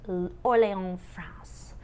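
Orléans said the French way, "or-lay-on", nasal ending; French accent. Shown as a demonstration. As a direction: put on your best French accent. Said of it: "with your best French accent".

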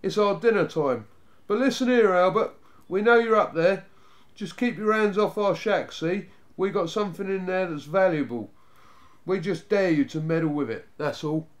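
loud, high challenge tapering off; calling out warning. A narrator's reading. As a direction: call a warning to someone hiding. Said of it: "called the eldest".

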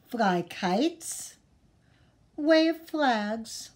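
slow, lively storybook read; reading to children. Acted. Teacher reading the windy day book to the class. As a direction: read it slowly for little listeners. Reads picture book aloud.

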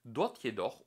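ordinary delivery

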